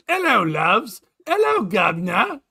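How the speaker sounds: high, loud mock-English greeting; mock English accent. Host answering how he is, in a mock English accent. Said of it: "as good as Terry Neeby in English".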